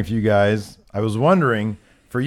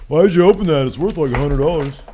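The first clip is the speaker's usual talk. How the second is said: mock commenter voice; 6 semitones higher, 5 dB louder than usual. Imagined commenters complaining.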